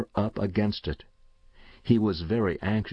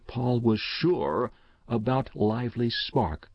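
plain reading voice

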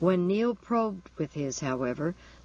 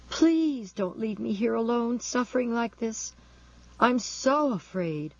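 pained, fearful pleading; 7 semitones higher than usual. A narrator's reading. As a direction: beg not to be abandoned, hurting and scared. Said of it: "shuddering with pain, with fear".

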